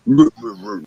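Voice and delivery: high imitated hooting noise; mock hooting. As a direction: copy the odd sound from next door. Imitating noise heard next door.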